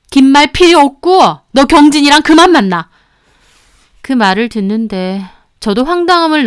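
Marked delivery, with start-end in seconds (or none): 0.1-2.9 high, loud flat order; cold command. Retold or imitated. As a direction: lay down the final word. Mother ordering breakup.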